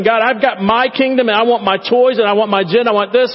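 mock selfish prayer. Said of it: "we're saying".

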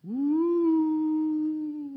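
long, high sustained eerie "woo"; ominous music. Acted. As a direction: imitate spooky soundtrack music with the voice. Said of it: "this is ominous music".

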